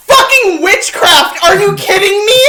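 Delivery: high loud exasperated shout; freaked-out yell. Real, not acted. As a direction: yell in disbelief. Wasp disappears, freaking out.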